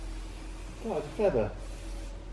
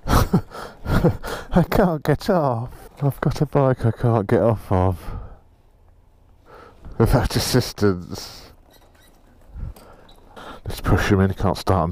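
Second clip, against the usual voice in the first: ordinary delivery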